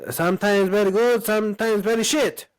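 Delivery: high-pitched mock Italian accent; Gennaro Gattuso. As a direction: imitate a famous rant in heavy Italian English. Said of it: "see if you guys remember this".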